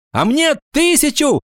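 high-pitched schoolboy yell. A narrator's reading. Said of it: "завопил Сеня Мордюков". (завопить по-мальчишески)

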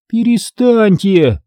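slow, high-pitched exasperated order; exasperated mother; impatient scolding. A narrator's reading. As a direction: cut off the squabbling children, stretching the word. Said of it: "нетерпеливо прикрикнула мама".

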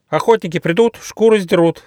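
very high, loud mocking screech; gleeful monkey. Monkey mocks the trapped tiger, gleeful. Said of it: "захохотала мартышка Коко".

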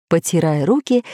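plain reading voice

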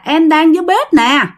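wife answering her husband from the kitchen, calling up; calling up the stairs. Retold or imitated. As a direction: call up to the floor above. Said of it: "nói với lên".

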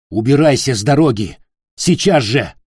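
stern order. A narrator's reading. Sword threat, orders him aside.

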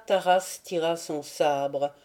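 ordinary delivery, nothing marked

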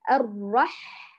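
الرح with the ح squeezed hard; wrong pressed ح. Shown as a demonstration. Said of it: "الخطأ الثاني الاحتكاك".

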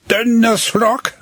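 high, startled exclamation; a boy crying out on seeing the carved face; surprised outcry. Acted. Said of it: "rief er".

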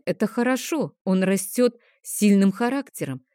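plain reading voice

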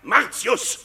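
hoch und laut, höhnisch geschrien; wütendes Anschreien. Acted. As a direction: den Namen dem Feind verächtlich ins Gesicht schreien. Aufidius schreit Coriolan an. (Aufidius schreit Martius voller Hohn und Zorn an)

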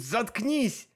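high narrated shout without much volume; exasperated cry. A narrator's reading. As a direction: snap it out in exasperation, pitch up. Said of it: "Мне хотелось крикнуть".

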